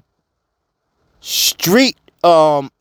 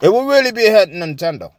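usual speaking voice

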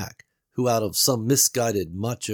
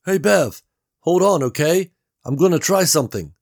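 raised, louder character voice for Gary; Gary calling through the wall; Gary's voice; 9 semitones higher, 8 dB louder than usual. A narrator's reading. Gary calls through the wall to Bev, raised voice.

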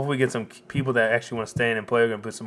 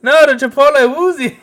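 much higher and louder hammed-up Canadian voice; mock Canadian fan; 13 semitones higher, 13 dB louder than usual. Canadian fan answering his wife in the background, loud mock Canadian accent. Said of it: "And his wife's in the background".

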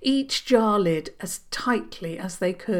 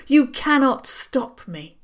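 defiant witch; 7 semitones higher, 4 dB louder than usual. Acted. Witch defies the heroes.

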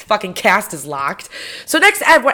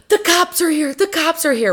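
acted alarm call; 9 semitones higher than usual. Guys warning a party that police arrived, acted alarm. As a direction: imitate someone raising the alarm. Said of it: "some guys run downstairs and they go".

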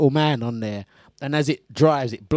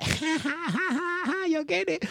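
high cackling mock laugh; 14 semitones higher, 8 dB quieter than usual. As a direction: cackle like she's teasing. Imitated cackle of sister.

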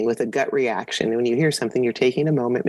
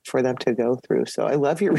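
normal talking voice

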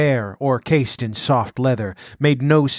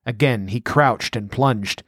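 plain reading voice

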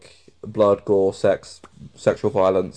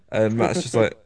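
normal talking voice

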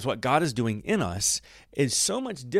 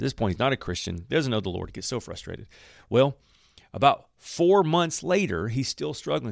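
ordinary talk

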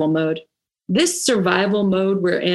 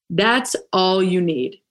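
ordinary talk

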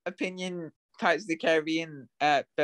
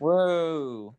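put-on cheer. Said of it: "John says, "Woo".

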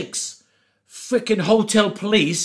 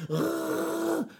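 high, strained hungover groan; 11 semitones higher, 10 dB quieter than usual. Retold or imitated. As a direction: croak like a zombie waking. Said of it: "Is something wrong with your voice?".

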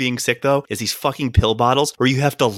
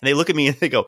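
ordinary talk, nothing marked

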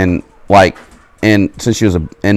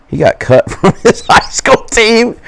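laughing roast; 13 semitones higher, slower than usual. Retold or imitated. Daughter roasting boyfriend, laughing.

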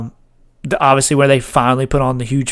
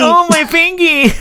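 put-on much higher, slow baby-talk whine; babyish echo; 14 semitones higher, slower than usual. Friend echoing the fingy line, slow high baby whine. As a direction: drag it out like a pouting little kid.

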